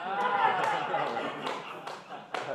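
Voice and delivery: loud unvoiced laugh. Real, not acted. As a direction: burst out laughing. Laughter at final punchline.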